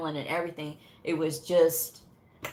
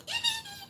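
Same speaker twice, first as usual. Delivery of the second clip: very high, soft squealing giggle; tiny squeal of glee; gleeful giggle; 7 dB quieter than usual. Retold or imitated. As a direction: titter with acted delight. Retold glee over new credentials.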